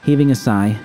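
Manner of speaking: plain reading voice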